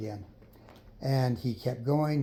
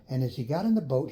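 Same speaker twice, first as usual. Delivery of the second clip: plain reading voice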